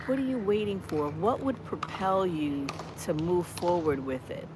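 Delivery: ordinary delivery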